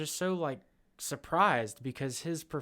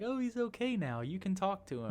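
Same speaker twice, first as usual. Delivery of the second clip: high, soft therapist voice, reassuring; mock soothing therapist; 6 semitones higher, 5 dB quieter than usual. Therapist vouching for the Joker, high and soft. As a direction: play the therapist calming the talk-show hosts. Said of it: "his therapist was like".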